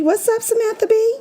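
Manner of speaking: high, playful shout-out; excited greeting. Acted. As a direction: hype her up. Greeting a celebrity with mock enthusiasm.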